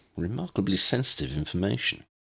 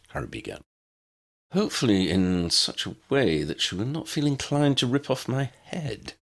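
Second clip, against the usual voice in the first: ordinary delivery, nothing marked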